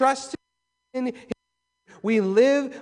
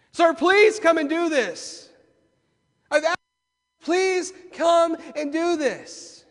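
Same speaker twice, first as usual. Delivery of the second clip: higher, slow pleading voice; urgent pleading; 9 semitones higher, 7 dB louder than usual. Retold or imitated. Father begging Jesus to come down, urgent pleading. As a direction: beg with mounting urgency. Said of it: "The urgency is there".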